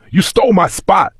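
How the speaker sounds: high angry bully's snarl; growled. A narrator's reading. Bully boy confronting Kofi over his spot, angry growl. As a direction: lay claim to the corner, threatening. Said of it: "the big boy growled".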